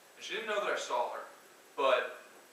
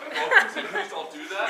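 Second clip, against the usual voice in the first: much higher, louder, slow and laughing; laughing plea; 12 dB louder, slower than usual. Retold or imitated. Speaker pleading with the boss, high laughing squeal. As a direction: beg through giggles in a squeaky voice. Joking plea about the threat.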